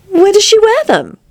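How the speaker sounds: high-pitched, wondering question; curious. A narrator's reading. Alice asking about the spikes, curious. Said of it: "asked with some curiosity".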